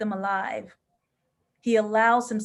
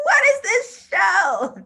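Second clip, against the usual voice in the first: laughing cry; 10 semitones higher, 7 dB louder, slower than usual. Real, not acted. Overwhelmed by the episode.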